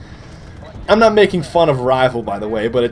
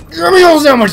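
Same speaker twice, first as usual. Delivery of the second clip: high, loud catchphrase yell; mock shout; 12 semitones higher, 8 dB louder than usual. Gamer yelling at Steve for his sandwich, high mock shout. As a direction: yell it far above your usual pitch, playfully.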